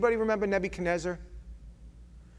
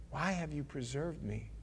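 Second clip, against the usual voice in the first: hushed question; 10 dB quieter than usual. Acted. Survivor asking God.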